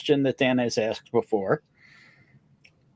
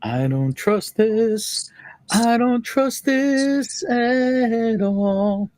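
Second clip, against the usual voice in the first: slow, drawn-out suspicious voice; voicing a wary viewer; 5 semitones higher, much slower than usual. Host acting out a viewer's doubt, slow and suspicious. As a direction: stretch every word like you smell a trick. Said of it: "Some guy named Gary doesn't trust this".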